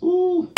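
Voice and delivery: squealed high ooh; a teasing falsetto ooh; mock-impressed high squeal. Teasing wives about camping.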